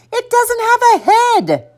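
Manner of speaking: very high, incredulous objection; exasperated mom voice; exasperated mother. A narrator's reading. Exasperated mother argues with son.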